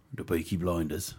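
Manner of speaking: low, gruff Birmingham voice; a hint of a Brummie gangster; a light Birmingham gangster accent; Peaky Blinders accent. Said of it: "That's a pretty good impression".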